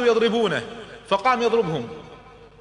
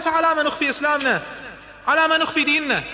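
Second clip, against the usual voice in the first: high, loud urgent question; demanding why they hide their faith; fervent protest; 13 semitones higher, 4 dB louder than usual. Acted. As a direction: press the Prophet passionately. Omar urges going public.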